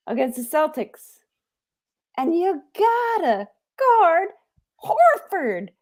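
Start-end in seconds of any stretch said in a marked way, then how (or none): 2.2-5.7 high, slow mock sob; hammed-up sobbing plea; mock crying. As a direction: whimper the words like a crushed fan. Fan crying about the loss.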